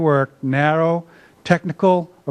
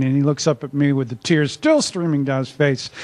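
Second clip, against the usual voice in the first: ordinary delivery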